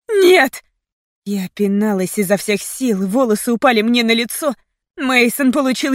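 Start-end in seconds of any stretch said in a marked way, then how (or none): 0.1-0.6 loud, high horrified scream. A narrator's reading. Heroine seeing Mason struck, horrified scream. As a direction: scream it in protest. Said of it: "и я закричала".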